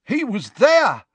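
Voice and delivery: high, loud urgent cry; shouted. Acted. Ministry wizard points out the rubble, shouting high. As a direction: shout it across the hall. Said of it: "shouted a scarlet-robed man".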